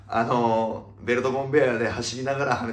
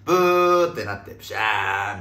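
imitated wrong note; 3 semitones lower, 5 dB louder than usual. Host showing the wrong-note penalty, imitated honk and splash. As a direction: honk and burst like a game-show penalty. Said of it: "音階間違えたら".

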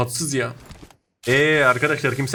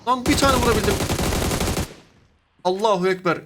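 high excited outburst; 8 semitones higher than usual. Real, not acted. Finally landing a hit.

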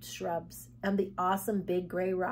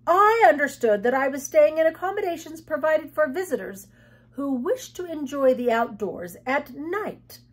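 high, loud, affronted guest; indignant prim guest; 6 semitones higher, 7 dB louder than usual. Acted. Guest refusing her lodgings, indignant. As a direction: complain formally, pitched up and forceful. The Mop complains, character voice.

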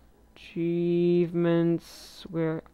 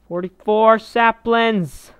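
high, loud, drawn-out announcement; stretched-out excited shout; excited exclamation; 6 semitones higher, 9 dB louder, slower than usual. Real, not acted. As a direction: call the count out slowly and loudly. Exclaims at sapling count.

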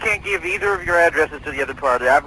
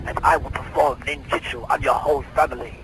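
villain voice taunt. Acted. Villain mocking the cop and the kid. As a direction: sneer it like a comic-book baddie.

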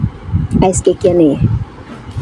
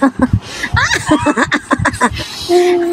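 bursting out laughing high; big helpless laugh; 9 semitones higher, 4 dB quieter than usual. Real, not acted. Challenge, someone laughs hard. (malakas na tawa)

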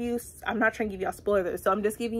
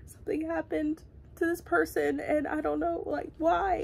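upset reader; 8 semitones higher, 4 dB quieter than usual. Retold or imitated. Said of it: "I'm like".

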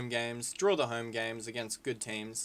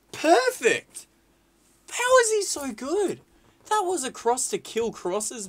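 high, loud, incredulous praise; thrilled fan, voice shooting up; amazed exclamation; 12 semitones higher, 7 dB louder, slower than usual. Real, not acted. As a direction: cry out in disbelief at brilliance. Amazed by a perfect cross.

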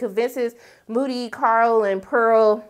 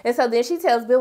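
usual speaking voice

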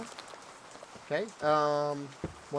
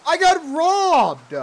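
high, loud, drawn-out cry of outrage; outraged shout; 13 semitones higher, 13 dB louder, much slower than usual. Pretend. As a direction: wail it slowly at full volume. Discovers the robbery in game.

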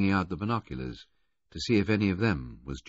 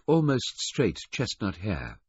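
ordinary delivery

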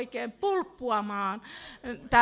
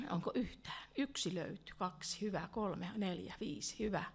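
soft, low-voiced count of raised hands; a hushed pastor counting responses; 3 semitones lower, 10 dB quieter than usual. Real, not acted. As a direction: count them off gently under the breath.

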